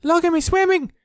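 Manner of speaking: very high, loud squeak; squeaky little Roo. A narrator's reading. Roo showing off his swimming, high loud squeak. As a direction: squeak it like a proud tiny joey. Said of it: "was answering".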